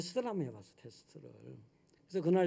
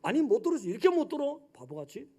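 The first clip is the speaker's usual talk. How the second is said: mild irritation; 8 semitones higher, faster than usual. Retold or imitated. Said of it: "막 화가 나기 시작하는 거예요".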